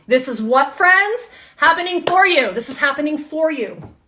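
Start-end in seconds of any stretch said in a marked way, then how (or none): 0.8-2.5 higher, louder punch on for; emphatic. Real, not acted. Reader correcting viewers' victim mindset, emphatic high push. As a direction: drive home happening for you.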